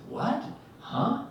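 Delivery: high-pitched mock bewilderment; short rising questions, well above own pitch; baffled disbelief. Husband hearing of bear at his head, baffled.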